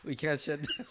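words carried on real laughter; laughing through a joke. Real, not acted. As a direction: try to finish while cracking up. Joke told while cracking up.

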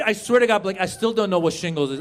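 ordinary talk, nothing marked

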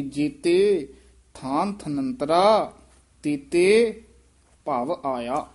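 ਥਨੰਤਰਾ slow and falling, ਤੇਤੇ punched; careful demo with pauses. Shown as a demonstration. Teacher shows the student the breaks at ਜੇਤੇ and ਤੇਤੇ. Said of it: "ਜੇਤੇ ਤੇ ਵੀ ਯਮਕ ਲੱਗ ਜਾਏਗੀ".

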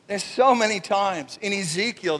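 ordinary talk

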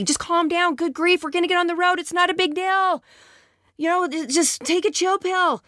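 husband waving off his overwhelmed wife, irritated and dismissive. Shown as a demonstration. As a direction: scold her to calm down like it's nothing. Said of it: "Does that not sound so much better than this?".

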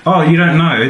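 ordinary talk

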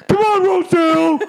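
very high, loud cheering-on; mock yell of a pressured husband; imitated yelling. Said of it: "You yell at her".

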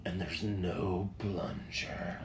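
slow, hushed confession; retold whisper. Boyfriend confessing clog to girlfriend, whispering. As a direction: whisper the bad news slowly.

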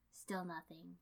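plain reading voice

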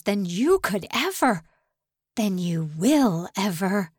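rising outburst. Acted. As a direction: let the anger break loose. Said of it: "The eruption he expected".

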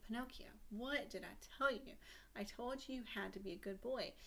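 soft, measured motherly scolding; quietly lecture, then promise a reward; fairy godmother. Acted. Fairy godmother stands over fainted Pinocchio, softly admonishing him.

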